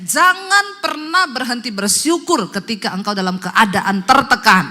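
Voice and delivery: slow, loud preaching; full-force exhortation. Acted. Preacher urges congregation to keep giving thanks, loud slow exhortation. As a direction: hammer each phrase out loudly and slowly.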